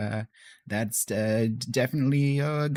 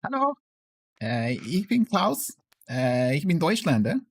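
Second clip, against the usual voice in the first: put-on German accent; 5 semitones higher than usual. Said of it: "very thick Lancashire accent".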